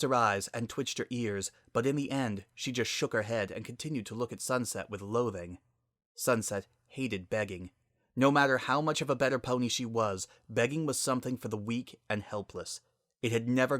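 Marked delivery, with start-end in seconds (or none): none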